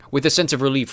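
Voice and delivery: ordinary delivery, nothing marked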